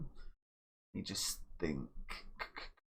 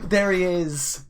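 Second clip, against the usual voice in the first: louder, lifted delight; excited cheer; 7 semitones higher, 8 dB louder, slower than usual. Real, not acted. Manager hails his scorer, excited and loud. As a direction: keep the goal buzz going. After goal celebration.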